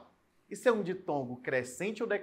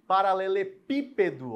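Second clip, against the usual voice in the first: "paralelepípedo" with the PI hit louder; stress on PI; 3 semitones higher, 6 dB louder than usual. Shown as a demonstration. Teacher giving a long example word, strong stress on PI in "paralelepípedo". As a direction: punch the PI in "paralelepípedo". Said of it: "O pi é a sílaba tônica".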